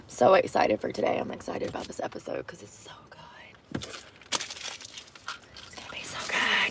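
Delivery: excited secret whisper; hushed eager whisper. Real, not acted.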